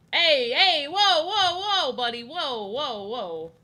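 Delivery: loud, high, put-on tough guy; loud mock Italian guy. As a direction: shout him down like a Jersey tough.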